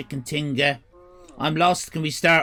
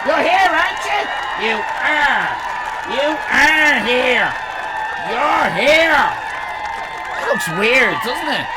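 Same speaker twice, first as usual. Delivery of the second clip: put-on high greeting; 6 semitones higher, 3 dB louder than usual.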